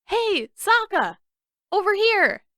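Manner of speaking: high, loud call across the street; yelled. Acted. Boy hailing his friend across the street, yell. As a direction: cup your hands and yell. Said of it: "cupped his hands around his mouth and yelled".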